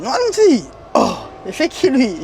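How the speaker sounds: high, loud echo of the name in disbelief; astonished reaction. Retold or imitated. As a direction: re-enact being stunned by who it is.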